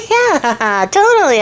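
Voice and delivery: high, giggly insincere yes; fake agreement. Princess humoring the frog's demand, mock-agreeable giggle. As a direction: laugh it off, humoring with a swoop up.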